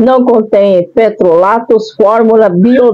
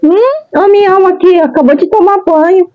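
excited; 8 semitones higher, faster than usual. Real, not acted. Shows her pet excitedly.

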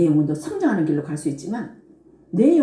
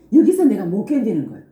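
ordinary delivery

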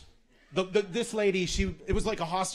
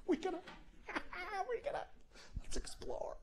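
giggly passengers; 10 dB quieter than usual. Retold or imitated.

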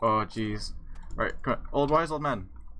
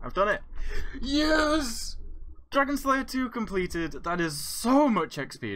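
high, exultant cheering; triumphant celebration; 13 semitones higher than usual. Real, not acted. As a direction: draw out the words in real victory. Celebrates completing the quest.

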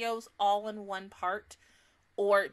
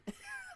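embarrassed laugh; 13 dB quieter than usual. Real, not acted. Laughs at stumbling word.

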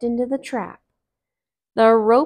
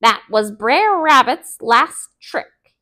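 Brer Fox; 3 semitones higher, 9 dB louder than usual. Acted. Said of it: "he said".

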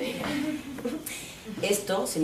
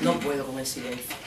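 ordinary delivery

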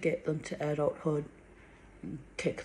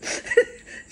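laugh; 4 dB louder than usual. Real, not acted. Laughing at own story.

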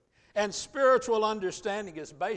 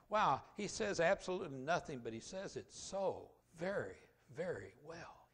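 hushed admiration; 7 dB quieter than usual. Acted. Acting out an impressed listener.

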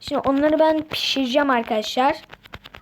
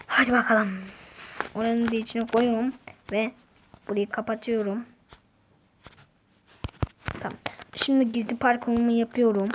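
ordinary talk

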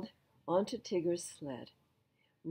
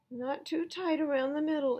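higher mild complaint; sighing Eeyore; 9 semitones higher, 4 dB louder than usual. Acted. Eeyore being tied to the sled, higher mild complaint. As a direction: complain with a sigh.

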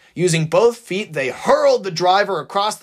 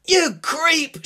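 higher, sharp angry yell; mock scream; 14 semitones higher than usual. Maxine yelling at the attacker, higher and furious. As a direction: hurl the insult at him. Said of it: "she screamed".

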